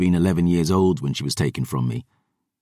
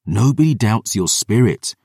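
ordinary delivery, nothing marked

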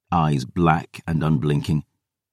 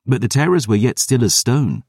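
usual speaking voice

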